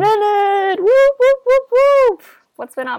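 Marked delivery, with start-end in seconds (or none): 0.8-2.2 high loud whooping siren; mouthed car alarm. Real, not acted. Cheering at the podcast's return.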